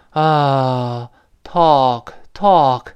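American "talk" with an open, drawn-out "ah"; American sigh-like vowel. Shown as a demonstration. Teacher contrasting American with British, open sighed American vowel. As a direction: relax into an American "ah", slowly. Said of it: "它变成叹气音".

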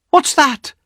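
high-pitched, alarmed wife's question; sharp rise into high-pitched alarm; startled cry. A narrator's reading.

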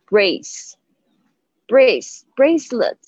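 "brace" drawn out with a long ay and final s; clear "ace" sound. Shown as a demonstration. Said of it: "它是发ace的声音".

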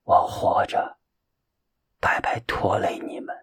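weak whispered last words; breathy, faint, fading; dying words. A narrator's reading. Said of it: "灿然一笑".

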